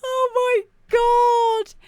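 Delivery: high, slow, stretched-out dismay; aghast. Real, not acted. Host reacting in horror, drawn out. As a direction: wail it out in disbelief.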